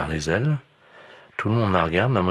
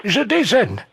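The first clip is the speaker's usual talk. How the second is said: high, loud granny; old lady voice; 6 dB louder, much slower than usual. Pretend. Old lady eating the seagull.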